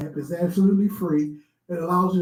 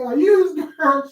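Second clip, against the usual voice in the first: mock comedian; 9 semitones higher than usual. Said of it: "He said".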